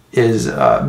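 ordinary talk

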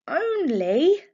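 incredulous high rising "Only?". Acted. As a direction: let the word climb in disbelief. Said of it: "her voice rising".

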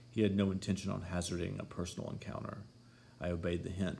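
ordinary delivery

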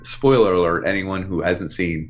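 ordinary delivery

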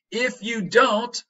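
high, loud and slow warning; slow dramatic read. Shown as a demonstration. Teacher reading Jack's warning slow and dramatic. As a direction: draw it out like a threat. Acting dialogue example.

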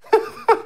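short, high chuckle; scoffing laugh. Real, not acted. Host scoffing at an impeachment over nothing, scornful chuckle. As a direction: laugh it off in disbelief.

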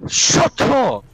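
high, loud, exasperated shout; angry outburst. Real, not acted. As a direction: cut them off with an angry yell. Real rant at teammate.

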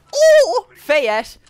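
very high, loud cry of triumph; celebrating a headshot loudly; triumphant shout. Real, not acted. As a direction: yell out the headshot.

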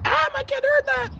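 high-pitched put-on parent voice; offended parent. Bystander objecting to swearing overheard in the mall, mock outrage. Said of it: "someone can be like".

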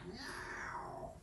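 cat meow. Acted. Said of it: "fece quella voce gattesca".

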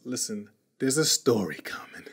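hushed teasing whisper. Acted. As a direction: lean in and whisper it.

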